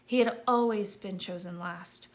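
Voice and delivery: ordinary delivery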